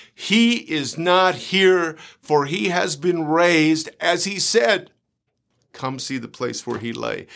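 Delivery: each phrase drawn out slowly; slow it down and let every word land; slow, deliberate reading. Shown as a demonstration. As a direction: savour the verse line by line. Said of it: "Let me say this again".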